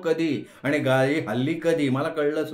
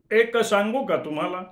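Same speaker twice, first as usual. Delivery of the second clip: higher, leaning in with a rising question; helpful gentleman; 6 semitones higher than usual. Acted. Gentleman on the platform offers advice, higher voice. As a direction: offer advice like a kindly stranger. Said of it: "एक सद्गृहस्थ सांगायला लागले".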